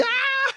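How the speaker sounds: very high hyena yelp; hyena cackle. Acted. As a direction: shriek like a laughing hyena. Hyena laugh imitated.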